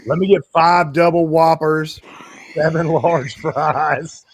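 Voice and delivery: a big, loud, slowed-down fast-food order; Hitman voice. Retold or imitated. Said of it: "is to sound like Hitman".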